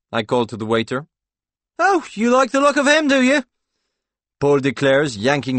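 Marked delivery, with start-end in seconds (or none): 1.8-3.4 high, loud mock-jealous challenge; stage a jealous row, pitched way up; jealous outburst. Paul staging a lovers' tiff with Claude, jealous outburst.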